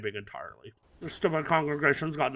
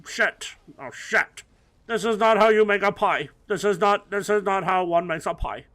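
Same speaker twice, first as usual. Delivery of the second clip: sputtering foiled villain; 5 semitones higher, 5 dB louder than usual. Acted.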